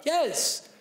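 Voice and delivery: put-on relieved child. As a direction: burst out as the understood kid. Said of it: "child agreeing".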